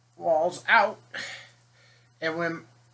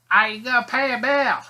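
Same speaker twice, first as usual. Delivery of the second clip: higher and louder, spelled out for a deaf ear; repeating louder; 6 semitones higher, 6 dB louder, faster than usual. Retold or imitated. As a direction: raise your voice so grandma hears. Repeating for hard-of-hearing grandmother.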